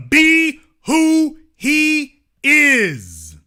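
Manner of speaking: slow, loud, stretched for emphasis; drawn out. Retold or imitated. Host reading article, slow emphatic drawl. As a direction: savor each word.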